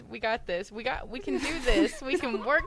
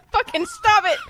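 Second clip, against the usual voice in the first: laughing shout; 10 semitones higher, 9 dB louder than usual. Real, not acted. Cracking up, scolding friends laughing.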